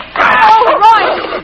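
high, loud cry of relief; relieved cry. Acted. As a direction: call out to her rescuer. Rescued captive cries out.

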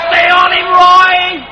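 loud, high cheer; cheering shout. Acted. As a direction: yell encouragement from the stands. Cheering rider from stands.

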